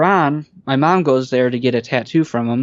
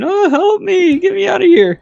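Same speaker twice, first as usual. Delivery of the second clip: put-on very high, louder panicked kid wail; mock screaming; 4 dB louder than usual. Kid locked in the cop car, imitated screaming. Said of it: "all you hear is the kid screaming".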